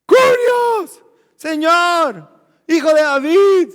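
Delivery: high, very loud pleading cries; desperate shouting. Acted. Canaanite woman calling on the Son of David, shouting. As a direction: scream for the Lord's attention. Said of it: "la cananea está gritando".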